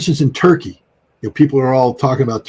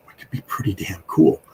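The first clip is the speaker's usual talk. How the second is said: whisper. Retold or imitated. Whispered end of imagined reply.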